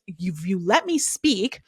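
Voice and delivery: slow, snotty "let me speak". Retold or imitated. Janet cutting Jasmine off, slow snotty tone. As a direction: spell it out slowly, talking down to her. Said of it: "you're not gonna use that tone with me".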